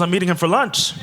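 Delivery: Doctor Edge amused at the coincidence; laughing reveal. Retold or imitated. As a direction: deliver it through a laugh. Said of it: "She goes".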